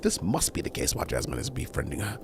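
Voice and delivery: ordinary delivery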